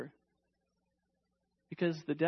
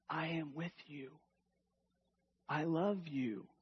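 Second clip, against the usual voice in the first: softer, gentle reassurance; tender God voice; 5 dB quieter than usual. Acted. Preacher voicing God to his hearers, tender and soft. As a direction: speak it softly as comfort. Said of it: "he says this".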